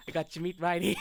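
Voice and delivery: laughing New York wise-guy jab; mock-tough New York accent, breaking into laughter. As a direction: crack it like a Brooklyn heckler. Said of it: "The accent".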